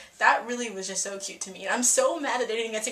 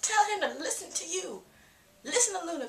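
high-pitched, emphatic fangirl urging; mock fierce cheerleading; 8 semitones higher than usual. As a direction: egg her on, pitched well up. Cheers Luna on in mock dialogue.

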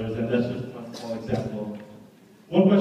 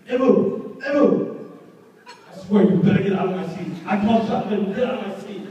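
much higher, loud, angry mock shouting; furious outburst; 8 semitones higher, 8 dB louder than usual. Friend yelling at Ebu to leave the front seat, furious shout. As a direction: act out a friend losing it over the seat. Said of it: "He just completely flipped out".

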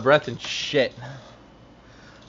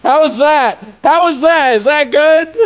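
loud, high, breathless asking; gasping out the question; breathless shouting; 13 semitones higher, 11 dB louder than usual. Real, not acted. Out of breath after dancing.

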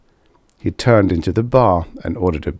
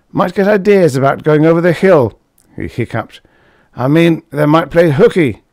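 a somewhat louder, higher tipsy loudmouth; Karsh, tipsy braggart; 6 semitones higher, 5 dB louder than usual. A narrator's reading. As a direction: play the boozy blowhard at the bar. Karsh voiced in story.

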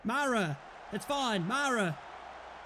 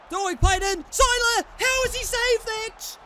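high, loud yell at the match; urgent raised holler; excited shouting; 6 semitones higher, 7 dB louder than usual. Real, not acted. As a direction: shout in disbelief at the save. Chance saved during match.

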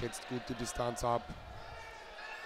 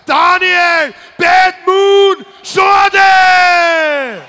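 long, soaring, high ring-announcer yell; drawn-out announcer shout; 26 dB louder, much slower than usual. Acted. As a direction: stretch the name out and roar it. Announcing fighter's name in the ring.

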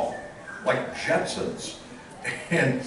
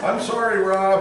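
ordinary delivery

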